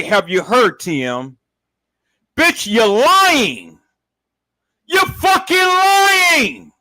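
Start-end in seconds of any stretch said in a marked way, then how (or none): 2.4-6.7 slow, loud, rising accusation; accusing shout. Real, not acted. Vlogger shouting that T M is lying. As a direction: drag it out and call her a liar.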